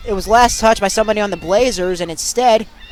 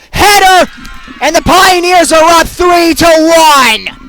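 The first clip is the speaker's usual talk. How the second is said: sudden loud shout, pitch jumps, then slow; goal call; 5 semitones higher, 4 dB louder, slower than usual. Real, not acted. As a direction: yell the goal like the crowd is rising. Goal scored, commentator erupts.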